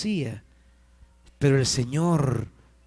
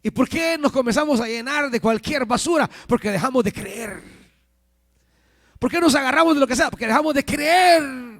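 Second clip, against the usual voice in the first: high, urgent preaching questions building to a shout; predicación insistente; 10 semitones higher, 4 dB louder than usual. Real, not acted. (predicando con insistencia, agudo y cada vez más fuerte)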